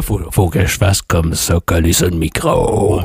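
low, close-mic grave voice; voice from beyond the grave. Shown as a demonstration. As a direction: lean into the mic, deep and hollow. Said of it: "ta voix d'outre-tombe que j'adore". (voix d'outre-tombe collée au micro)

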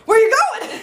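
high, loud call after someone leaving; mock yell. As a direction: shout it after him as he walks away, half laughing. Calling after husband walking away.

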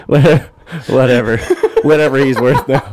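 words through laughter; laughing while correcting himself. Real, not acted. Laughing at Forty Cent.

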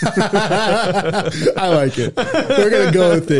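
laughing through the words; can't stop laughing. Real, not acted. Laughing at title.

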